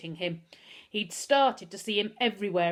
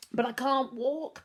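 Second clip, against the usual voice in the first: howling; 7 semitones higher, 4 dB louder, slower than usual. A narrator's reading. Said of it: "Pickering howled".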